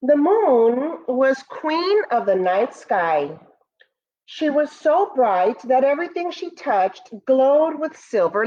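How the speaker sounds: slow, gentle read-aloud voice; storybook narration. Acted. Teacher reading Moon's First Friends to students, storybook voice. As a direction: read it like a bedtime story for the class. Reads picture book aloud.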